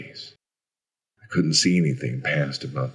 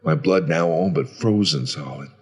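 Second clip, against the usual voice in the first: ordinary delivery, nothing marked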